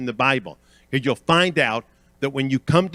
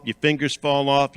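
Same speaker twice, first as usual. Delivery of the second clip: ordinary talk, nothing marked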